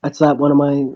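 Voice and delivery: plain reading voice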